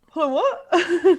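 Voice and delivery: high, loud, slow mock bafflement with laughter; baffled retold reaction. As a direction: replay your own confusion, laughing. Retold baffled reaction to Polish name.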